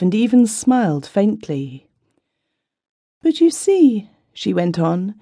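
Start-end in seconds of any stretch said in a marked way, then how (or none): 3.2-4.1 high, plaintive complaining voice; a distressed wife pouring out her worries; tearful young wife. A narrator's reading.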